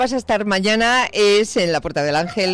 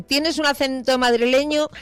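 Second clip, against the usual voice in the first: mock quote; 8 semitones higher than usual.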